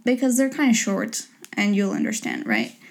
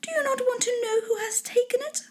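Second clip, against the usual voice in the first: very high, soft-edged impatient plea; high, put-on impatient lady voice; impatient wife; 5 dB quieter than usual. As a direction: pitch it way up as a wife itching to tell. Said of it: "cried his wife impatiently".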